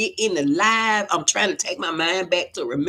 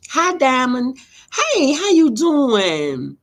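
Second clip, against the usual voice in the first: higher, louder, drawn-out hello to a viewer; slow, high, bright hello; excited greeting; 7 semitones higher, 5 dB louder, slower than usual. Real, not acted. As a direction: sing out a big welcome.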